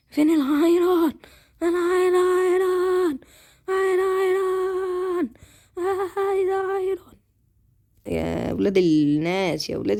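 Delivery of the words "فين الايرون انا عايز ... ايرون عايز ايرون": whiny begging. Real, not acted. Begging for iron in game. (نبرة زن طفولية عالية وبطيئة)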